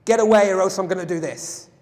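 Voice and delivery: loud threatening shout; mock threat. Shown as a demonstration. Trainer acting out a threat to the class, loud and menacing. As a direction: bark a threat to make the point. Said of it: "if I threaten somebody".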